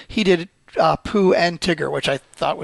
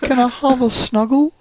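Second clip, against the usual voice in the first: higher, sweet storybook bear voice; Winnie the Pooh; 6 semitones higher, slower than usual. Acted. Cohost showing off a bear impression, childlike and pleading. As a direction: plead like a cuddly cartoon bear. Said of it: "I used to do Winnie the Pooh much better".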